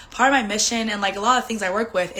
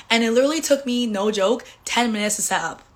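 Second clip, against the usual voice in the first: ordinary delivery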